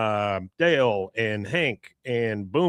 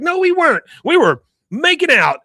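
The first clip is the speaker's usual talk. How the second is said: much higher, louder shrill put-on voice; mock Peggy squeal; 7 dB louder than usual. Peggy fibbing to her son, high squealing mock voice. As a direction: squeal it like a flustered mom.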